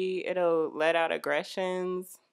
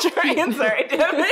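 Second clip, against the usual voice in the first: higher and louder, words breaking into laughter; cracking up mid-sentence; laughing apology; 10 semitones higher, 9 dB louder than usual. Real, not acted. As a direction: giggle your way through the sorry.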